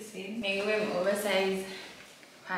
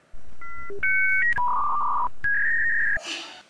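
long shriek of a laugh, very high; 12 dB louder than usual. Real, not acted. Vlogger mocking a reality star's accent, shrieking laugh. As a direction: cackle way up high in disbelief. Reacting to his annoying accent.

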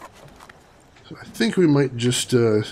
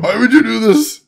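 higher, louder, laughing through the words; laughing disbelief; 10 semitones higher, 4 dB louder than usual. Real, not acted. Streamer cracking up at terrible video graphics, laughing disbelief. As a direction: ask it again, cracking up this time.

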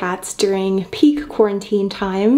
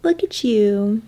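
sympathetic aww; 4 semitones higher, slower than usual. Real, not acted. Cooing over rescue kitten.

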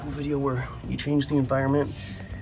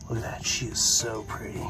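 soft admiring whisper; 3 semitones lower, 7 dB quieter than usual. Real, not acted. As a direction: whisper in awe. Whispering at the crocodile.

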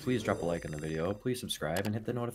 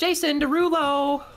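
singer callout; 9 dB louder, slower than usual. Acted. Gambling stream hype callout.

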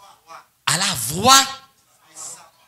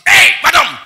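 loud, high mock yell; shouted hey, madame at a woman; noisy shout; 9 semitones higher, 14 dB louder than usual. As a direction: bark it like a rowdy man. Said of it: "Tout nèg, ou dit".

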